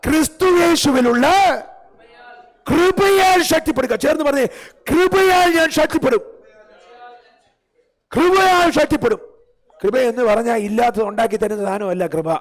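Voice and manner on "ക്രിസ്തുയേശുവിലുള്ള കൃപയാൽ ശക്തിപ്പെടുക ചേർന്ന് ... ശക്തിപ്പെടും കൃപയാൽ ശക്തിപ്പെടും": rally the church to shout it back; shouted declaration. Acted. Preacher leading the congregation in a loud declaration.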